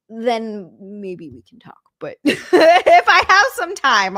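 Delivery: slow, breaking into laughter; laughing. Real, not acted. Streamer to Frank, laughing while promising to get help. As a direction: laugh through the words at your own dodge. Laughing at her own excuse.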